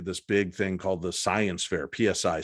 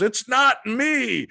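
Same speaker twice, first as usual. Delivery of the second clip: high, loud, very drawn-out denial; mock protesting shout; 6 dB louder, much slower than usual. Friend denying the prank, high protesting shout. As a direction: cry out the denial high and slow.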